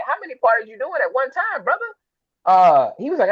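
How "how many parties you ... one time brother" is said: pitched way up, in mock disbelief; incredulous question. To Idris as he leaves, high incredulous disbelief. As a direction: ask it like you can't believe him. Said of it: "I was like".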